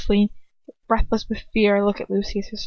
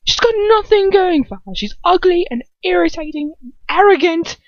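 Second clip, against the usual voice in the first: much higher, louder building rant; incredulous rant; 10 semitones higher, 7 dB louder than usual. Acted. Draco ranting at his father about her, high and loud. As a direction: spit out the insults in disbelief.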